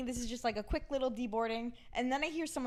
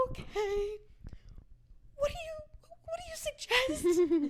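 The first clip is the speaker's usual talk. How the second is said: timid high-pitched plea; high, slow, meek ask; 14 semitones higher than usual. Retold or imitated. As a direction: voice shoots up high and pleading. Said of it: "And I go".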